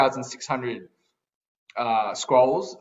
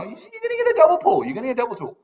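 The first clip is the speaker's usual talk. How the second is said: high, sing-song mock of viewers chanting; taunting falsetto mimicry of the crowd; faster than usual. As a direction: parrot the viewers' promise in a silly high voice. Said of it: "you're all saying".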